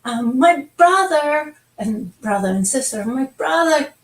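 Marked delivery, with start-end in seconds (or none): 0.4-1.5 put-on higher, louder kid's tattling voice; complaining child. Mother imitates daughter tattling on brother, higher kid voice. 3.0-3.9 put-on tattle like an upset little girl; complaining child. Mother imitates daughter tattling on brother, higher kid voice.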